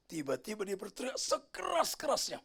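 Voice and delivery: ordinary delivery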